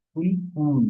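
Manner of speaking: কুল with short u, then কুল with long u. Shown as a demonstration. Teacher showing how it is done, short then long. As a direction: say কুল quickly, then stretch it. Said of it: "এভাবে".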